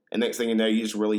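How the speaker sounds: ordinary delivery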